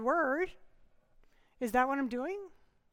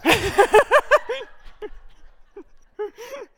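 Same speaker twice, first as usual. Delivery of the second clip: a long, high, loud nervous laugh; 9 semitones higher, 11 dB louder than usual. Real, not acted. Pastor laughing nervously at his own joke, high and loud. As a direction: laugh off the nerves, pitch up and loud. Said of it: "I use humor when I start to get nervous".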